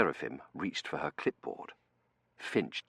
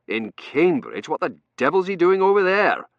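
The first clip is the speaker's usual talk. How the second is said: indignant disbelief; 12 semitones higher, 14 dB louder than usual. Acted. Dunworthy asking what Chiswick is doing there, indignant.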